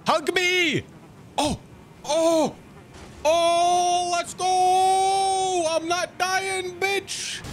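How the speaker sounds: high, slow desperate hype; frantic excited yelling. Real, not acted. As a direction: scream through the close call. Desperate boss fight survival.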